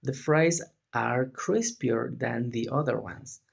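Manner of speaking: slow, careful English example; slow model sentence. Shown as a demonstration. Teacher reading a model sentence to students, slowly. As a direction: spell it out slowly for learners. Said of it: "para yo decir".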